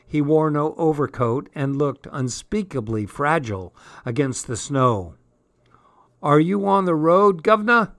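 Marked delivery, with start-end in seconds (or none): 6.2-7.9 put-on cockney street boy, higher and louder; boy's voice. A narrator's reading. Boy calling to the tramp he overtakes, lightly voiced. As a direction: call out to the passing stranger. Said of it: "asked the boy huskily".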